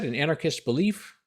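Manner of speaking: ordinary talk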